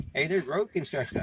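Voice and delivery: usual speaking voice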